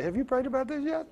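high, soft, sing-song quote; quoting the pastor. Retold or imitated. Pastor quoting his own advice back to himself, high and soft. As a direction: lift it up lightly like a gentle reminder. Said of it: "my pastor would say".

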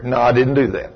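normal talking voice

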